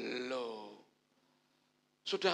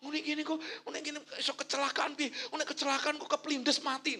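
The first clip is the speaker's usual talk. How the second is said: higher, mock anxious rambling; catastrophizing; 8 semitones higher than usual. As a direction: spiral into imagined disaster. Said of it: "malah ditambahi meneh".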